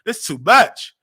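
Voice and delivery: flustered friend at her tears; overwhelmed. Retold or imitated. Friend seeing her crying, loud flustered exclaiming. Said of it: "He said".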